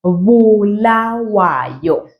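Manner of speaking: Bulawayo said very slowly, each syllable drawn; slow model. Shown as a demonstration. Teacher modelling the city name, slowly. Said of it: "pronounce it just like that".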